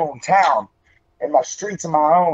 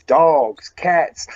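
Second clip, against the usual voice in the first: ordinary talk, nothing marked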